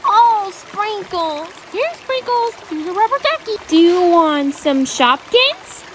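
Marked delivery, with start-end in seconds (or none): none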